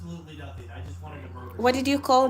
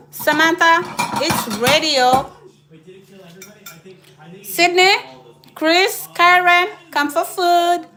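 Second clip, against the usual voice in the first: loud high call across the house; 4 semitones higher, 4 dB louder than usual. Real, not acted. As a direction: call everyone to the table. Calling family to come eat.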